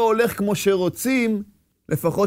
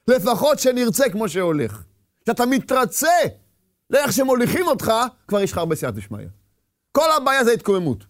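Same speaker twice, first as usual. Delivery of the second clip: ordinary talk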